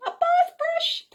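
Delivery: whispered echo. Acted. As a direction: whisper it like a secret. Narrator echoes in a whisper.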